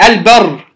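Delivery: البر with a clear vowelless ل before the ب; إظهار اللام. Shown as a demonstration. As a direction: sound the ل distinctly in "البر". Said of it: "أظهرنا اللام الساكنة". (البر بإظهار اللام الساكنة)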